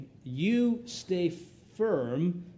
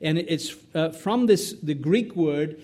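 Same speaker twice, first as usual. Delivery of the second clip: ordinary talk, nothing marked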